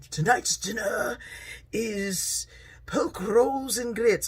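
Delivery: mock-posh British accent, high and drawn out; posh British butler. Daughter playing butler announces tonight's dinner, posh British accent. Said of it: "answers in this really posh British accent".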